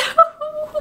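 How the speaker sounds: squeaky high burst of giggling; high giggle. Real, not acted. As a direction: let a high giggle escape. Giggle after cute bookmark.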